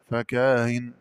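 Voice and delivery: فكاهن with a short aa before the eased hamza; unstretched aa in فكاهن; short madd. Shown as a demonstration. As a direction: say فكاهن briefly as "fakaa-in". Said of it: "مع المد والقصر".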